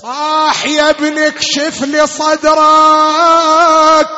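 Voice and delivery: ordinary talk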